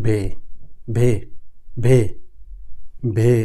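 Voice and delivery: "বে" drilled as a long "veh"; German letter W. Shown as a demonstration. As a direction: repeat "বে" slowly, a pause after each. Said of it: "ডাব্লিউ মানে".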